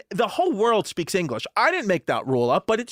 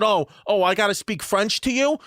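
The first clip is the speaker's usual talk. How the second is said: raised, mimicked sarcastic question; mock incredulity; 5 semitones higher than usual. Friend's mom mocking French demands, sarcastic raised voice. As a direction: imitate a Brooklyn mom scoffing. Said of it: "real deal Brooklyn lady smoking cigarettes".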